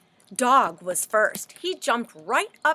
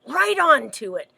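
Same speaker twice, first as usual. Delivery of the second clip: ordinary delivery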